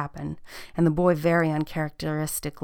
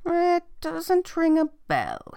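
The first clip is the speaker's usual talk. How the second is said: high, drawn-out royal pondering; puzzled king; 13 semitones higher, 3 dB louder, slower than usual. Acted. Puzzled king answers the queen.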